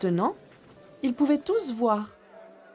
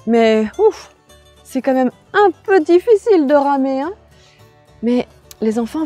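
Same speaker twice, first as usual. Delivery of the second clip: put-on bright children's host; 3 semitones higher, 9 dB louder than usual.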